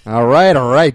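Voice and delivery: loud. Acted. Acted reply to rant.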